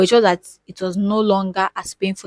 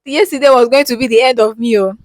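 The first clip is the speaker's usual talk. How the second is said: amazed relief; 5 semitones higher, 9 dB louder than usual. Retold or imitated. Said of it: "I said".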